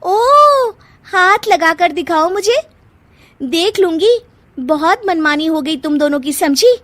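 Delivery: loud, high, defiant. Acted. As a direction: stand up to her, shouting. Quarrel, defiant reply.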